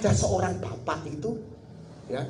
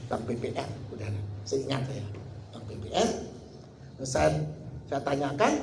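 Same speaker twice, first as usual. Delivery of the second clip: usual speaking voice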